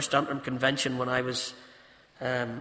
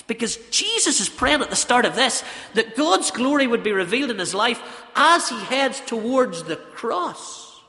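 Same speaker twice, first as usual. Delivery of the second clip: loud, high-pitched sermon delivery; ringing, forceful pulpit voice; impassioned preaching; 7 semitones higher, 8 dB louder than usual. Real, not acted. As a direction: preach it hard, driving each phrase home.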